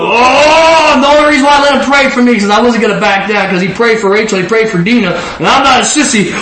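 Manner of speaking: put-on a lightly laughing send-up of a proud kid; mock macho bravado. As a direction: ham up your stubborn younger self. Mocks his younger self.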